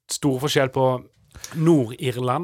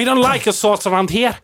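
put-on higher, louder Irish accent; 8 semitones higher, 6 dB louder than usual. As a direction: repeat it so they can hear the Irish. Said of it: "Jeg hører det ikke".

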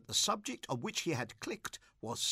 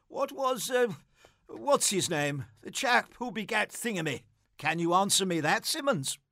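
a hint of a tipsy interrogator, louder; lightly voiced suspicious Gussie; 5 semitones higher, 5 dB louder than usual. Tipsy Gussie cross-examines the boy, lightly voiced and louder. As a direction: grill the boy with mock suspicion.

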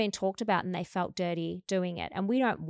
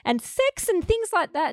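high loud confession; 11 semitones higher, 8 dB louder than usual. Retold or imitated. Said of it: "And I'm like".

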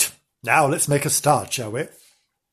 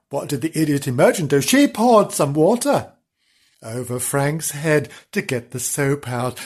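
plain reading voice

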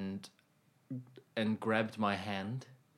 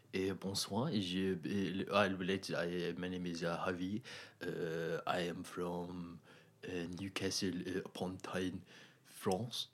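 a lightly put-on French accent, low and soft; hammed-up mock French accent, quiet and low; bad French accent; 4 semitones lower, 7 dB quieter than usual. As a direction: fake a French accent half-heartedly. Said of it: "Could I hear your French accent?".

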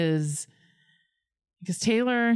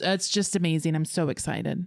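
usual speaking voice

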